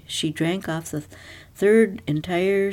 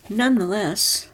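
ordinary delivery

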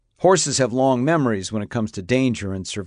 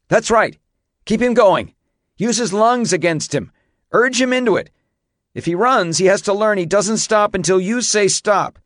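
high, raised instructions over distance; shouted coaching; 10 semitones higher, 5 dB louder than usual. A narrator's reading. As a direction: call out to keep the rider at it. Coaching rider through runaway.

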